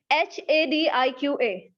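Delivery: slow letter-by-letter "एच ए डी आई क्यू ए"; spelling out letters. Shown as a demonstration. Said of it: "कितने alphabets मौजूद है". (एक-एक अक्षर बोलकर)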